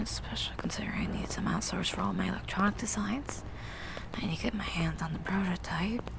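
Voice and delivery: soft, unvoiced whispered planning. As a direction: whisper the list to yourself. Murmurs shopping plans to herself.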